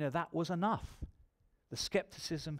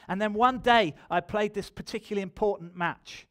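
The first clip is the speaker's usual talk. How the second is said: loud, raised-pitch testimony preaching; forceful preaching; 5 semitones higher, 8 dB louder than usual. Real, not acted. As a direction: drive the testimony out to the whole room.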